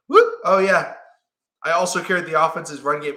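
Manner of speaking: imitating Lamar shrugging off a big rushing game; casual Lamar. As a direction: toss it off like it's nothing. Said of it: "it's just so casual".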